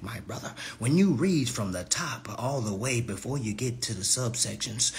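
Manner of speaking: hushed, low, restrained explaining; mock respectful. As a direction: put on patient calm, soft and low. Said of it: "we supposed to be respectful".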